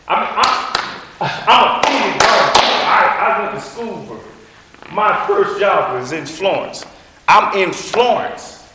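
loud, slow, rising preacher's cry; fired-up preaching. Real, not acted. Preacher fires up the church telling his story, loud and slow. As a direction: preach it out at full force. Preacher building to full force.

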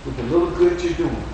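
soft, gentle voice of comfort; tender reassurance. Acted. God comforting weary Christians, soft and tender. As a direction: speak softly as God consoling the weary.

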